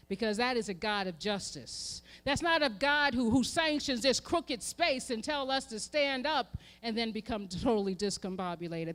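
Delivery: ordinary delivery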